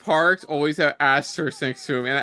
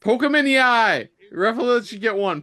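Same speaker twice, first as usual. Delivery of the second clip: shouting at the screen; 8 semitones higher than usual. Real, not acted. Shouting advice at wrestling screen.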